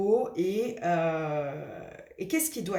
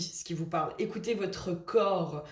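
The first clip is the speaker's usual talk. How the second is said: ordinary delivery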